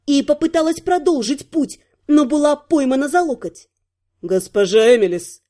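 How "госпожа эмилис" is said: low, strained male warning; terse disapproval. A narrator's reading. Heir catches the heroine by the elbow, disapproving. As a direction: squeeze the name out with restrained anger. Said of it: "процедил наследник".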